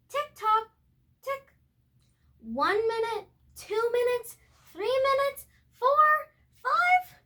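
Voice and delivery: building suspense. A narrator's reading. Narrator counting down the minutes, building excitement. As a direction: climb in pitch as the minutes tick.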